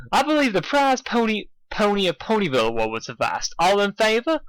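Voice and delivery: high, formal mayor; proper town mayor. Acted.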